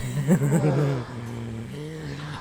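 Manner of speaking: gloating evil laugh; villain laugh. Acted. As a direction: laugh in triumph over him.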